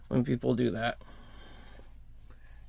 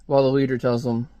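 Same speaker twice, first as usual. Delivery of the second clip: plain reading voice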